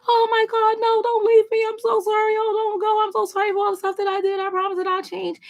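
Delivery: high, fast mock-whining plea; mock the ex begging you not to leave; whiny pleading ex. Reader mocks ex begging not to go, high whiny plea.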